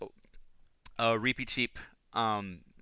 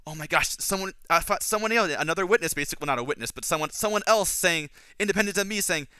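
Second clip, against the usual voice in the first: higher-pitched, mildly excited retelling; delighted; 6 semitones higher, 3 dB louder than usual. Retold or imitated. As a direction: play up the thrill of finally being backed up. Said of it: "it's to Reepicheep's delight".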